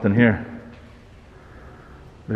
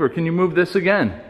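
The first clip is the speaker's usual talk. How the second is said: higher-pitched, insistent pleading requests; calling to a spirit; 4 semitones higher than usual. Real, not acted. Investigator asks a spirit to move the rack, calling out. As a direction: ask the unseen presence, pitched up and projected.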